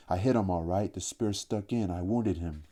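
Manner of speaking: ordinary delivery, nothing marked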